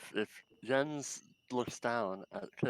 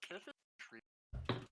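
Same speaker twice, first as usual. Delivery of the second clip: far from the microphone; far away; 13 dB quieter than usual. Real, not acted. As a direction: voice distant and faint. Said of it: "Why do you sound so far away?".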